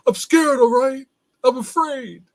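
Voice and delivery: loud, high outburst of admitting fear; Rocky. Retold or imitated. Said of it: "Where Rocky's on the beach".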